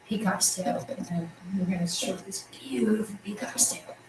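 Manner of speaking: soft unvoiced aside; hushed whisper to the class. Real, not acted. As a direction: breathe it like a secret. Whispered aside about peacock.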